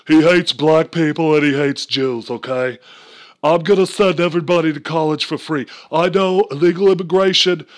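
put-on loud, high, ranting old senator; Bernie Sanders impression. Comic mocking Bernie at a rally, loud and high. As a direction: shout it like an old man on the stump. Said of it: "Bernie Sanders is like".

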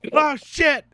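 high, loud panicked yelp; mock panic. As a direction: yell it like the kitchen's burning. Reenacting panic in tutorial.

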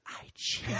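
unvoiced, gloating whisper. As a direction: whisper the secret into his ear. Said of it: "whisper in his ear".

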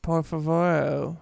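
slow, exaggerated gringo Spanish; mock Spanish accent. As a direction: ham up a tourist Spanish.

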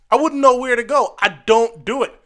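ordinary talk, nothing marked

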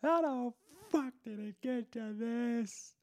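very high, strained whine of a washed-up self; future self lament. Retold or imitated. Future self watching old podcast, whining high. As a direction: whine like a future loser mourning lost luxuries. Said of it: "I'll be like".

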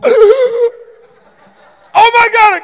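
high wheezing cry, half laughing; winded and wheezing. Retold or imitated. Pastor retelling the punch, wheezing high with laughter. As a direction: squeeze it out with no air. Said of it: "all I go".

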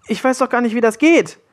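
put-on high, insistent boy's voice; protesting boy. A narrator's reading. Willi objects to the pirate's order to heal, high protest.

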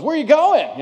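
high, loud taunting jeer; mocking. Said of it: "in a real kind of mocking voice".

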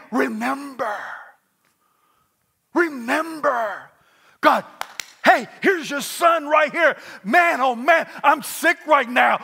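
loud, high, desperate begging; pleading prayer. Acted. Preacher voicing Hezekiah begging God from his sickbed. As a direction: beg God to remember him. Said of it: "He prayed to the Lord, saying".

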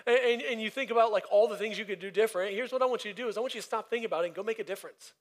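urgent call to action from the pulpit; preaching push. Acted. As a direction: drive it home to the congregation. Builds to a charge.

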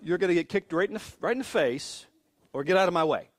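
pretend-angry, pushing past someone; mock threat. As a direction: act out a protective father's threat. Imagined threat to dismissive onlooker.

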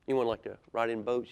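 plain reading voice

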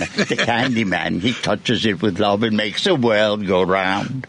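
put-on sing-song the lyric in a mild English accent. Radio host imitating a singer's English accent. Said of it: "but with an English accent".

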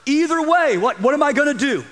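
high-pitched, lightly flustered; acted exasperation. Preacher as Pilate weighing his choice, mildly exasperated. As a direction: play at being stuck with no good option.

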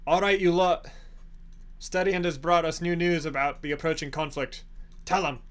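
rally the tavern crowd; calling out to the room. Acted. Herrick on a bar stool addressing the tavern crowd, loud call.

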